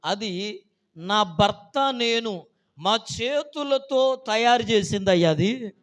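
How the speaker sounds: a hint of a grieving old widow, loud and slow; emotional widow. Old widow telling the pastor why she weeps, loud and slow. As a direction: plead it out slowly, moved to tears.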